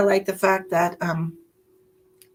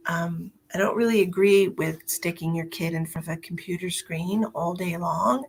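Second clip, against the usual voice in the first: ordinary talk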